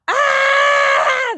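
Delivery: long, high, loud mock scream; drawn-out high "aaah" of pretend panic; mock panicked scream. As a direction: scream like you're clinging on, hammed-up. Acting out the panicked resisters.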